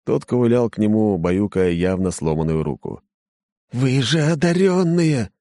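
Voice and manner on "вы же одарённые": disbelieving cry. Acted. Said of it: "повторил слуга".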